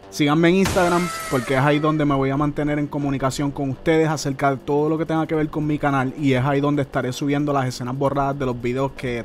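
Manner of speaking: brisk promo read over music; upbeat announcer plug to viewers. Acted. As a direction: pitch the channel like a sponsor spot. Host breaks in with a channel plug.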